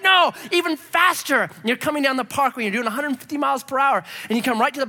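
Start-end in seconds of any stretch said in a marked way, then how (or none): 0.0-1.4 higher, louder, drawn-out defiance; defiant shout. Retold or imitated. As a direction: shout it back at the helicopter. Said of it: "You're like".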